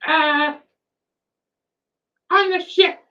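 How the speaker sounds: a flustered, eager-to-please character; nervous NPC voice. Acted.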